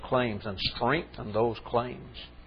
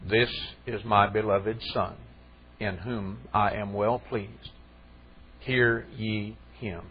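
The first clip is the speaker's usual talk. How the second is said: lower, softer, slow and solemn divine voice; solemn voice from heaven; 4 semitones lower, 5 dB quieter, slower than usual. Acted. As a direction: speak it slowly as the Father from heaven. Voice from heaven speaks.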